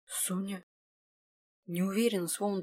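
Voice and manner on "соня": soft, unsure whispered question; uncertain whisper. Acted. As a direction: whisper it as if just waking. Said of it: "прошептал он".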